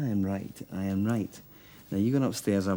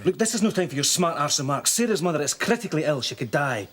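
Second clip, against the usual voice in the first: sharp rebuke, pitched up; snapping; 5 semitones higher than usual. Acted. Rebuking Dominic for smart remarks while Sarah's mother is ill, snapping. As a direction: snap at him for joking. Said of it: "I'm sorry I snapped".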